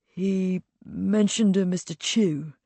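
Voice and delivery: reluctant. A narrator's reading. Abbott reluctantly names a suspect to Pierpont, hesitant. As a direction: say it reluctantly, not wanting to accuse. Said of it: "said Abbott a little reluctantly".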